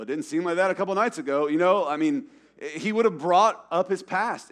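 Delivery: high, loud preacher climax; preaching at full force. Acted. Pastor pressing the congregation with rising, loud preaching. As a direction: drive the point home to the room.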